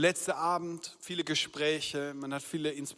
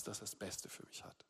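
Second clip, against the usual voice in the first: whispered end of the question; 8 semitones lower, 18 dB quieter than usual. Real, not acted. Preacher asking the congregation, trailing into a whisper. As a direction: drop to a whisper on the last thought.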